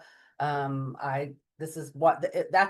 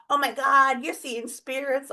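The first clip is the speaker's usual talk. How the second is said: sister-in-law exclaiming over spirits, high and thrilled; excited friend; 9 semitones higher, 4 dB louder than usual. Retold or imitated. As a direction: imitate an eager believer, all worked up. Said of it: "She's all excited".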